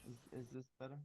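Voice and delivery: very faint, hard to make out; barely audible. Real, not acted. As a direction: ask it almost inaudibly. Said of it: "It's low again".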